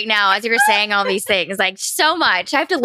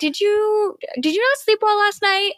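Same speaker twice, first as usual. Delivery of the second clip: mimicking partner; 11 semitones higher than usual. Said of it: "he'd be like".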